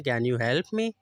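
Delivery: normal talking voice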